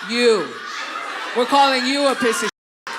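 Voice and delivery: loud, emphatic acted retort; defiant comeback. Daughter answering the angry driver, defiant loud retort. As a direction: throw it right back at him.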